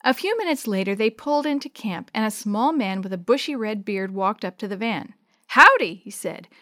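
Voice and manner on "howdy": loud, high howdy; hearty greeting. Acted. River guide greeting the kids at the van, hearty. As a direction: hail them loudly across the camp.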